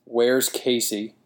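plain reading voice

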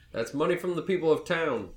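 yelled objection. Acted. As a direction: call it out in mild indignation. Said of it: "Rufus yells out and he says".

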